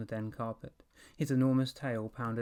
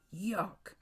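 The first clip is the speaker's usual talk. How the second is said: higher, disgusted cry; disgusted yuck; 8 semitones higher than usual. A narrator's reading. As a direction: reel from the stink. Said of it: "Evan cried".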